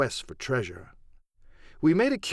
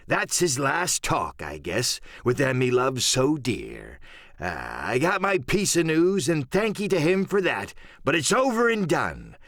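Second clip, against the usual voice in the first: higher-pitched sea-dog voice, lingering on words and dropping on the asides; scheming pirate cook; 3 semitones higher than usual. Acted. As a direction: win the crew over with a pirate's sly swagger.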